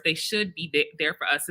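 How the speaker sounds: ordinary talk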